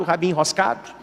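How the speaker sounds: ordinary talk, nothing marked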